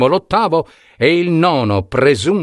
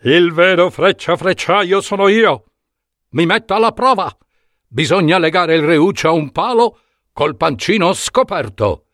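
boastful archer; 6 semitones higher than usual. Acted. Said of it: "E ognuno di essi diceva".

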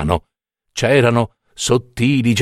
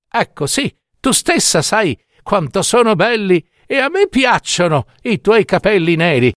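put-on higher-pitched old woman striking a bargain; lilting, persuasive little old woman; coaxing old woman; 5 semitones higher than usual.

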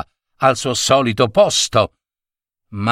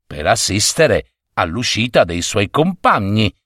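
ordinary delivery

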